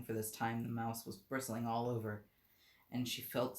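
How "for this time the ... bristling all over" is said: ordinary delivery, nothing marked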